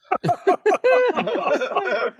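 high-pitched burst of laughter. Real, not acted. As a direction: cracks up at his own punchline.

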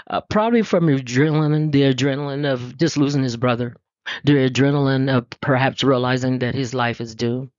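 usual speaking voice

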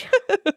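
high cackling witch laugh. Pretend. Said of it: "Oh, it's my witch laugh".

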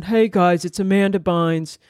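lightly imitated flat monotone greeting; a hint of a dead, level vlog intro; mock monotone celebrity voice. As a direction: say it in a dull, even drone. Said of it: "that voice, that, like, monotone".

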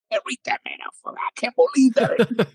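high, whispery mock outburst; strained whispered demand, slowing into disbelief; retold cursing disbelief. Player urging his brother to read the letter, incredulous whispered outburst. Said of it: "I started cursing at him and going".

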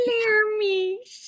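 high, slow mangled "Lermish" in a made-up accent; weird accent. Shown as a demonstration. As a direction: croon "Lermish" in a silly invented accent. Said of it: "calling her Little Miss in a really weird accent".

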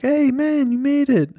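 high, mock-casual buddy voice; buddy's voice. Friend in bed greeting the returning runner, high mock voice. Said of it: "And he's like".

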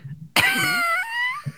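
high squealing giggle fit; hiccup giggles. Real, not acted. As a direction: burst into uncontrollable high giggles. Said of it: "I just can't stop laughing".

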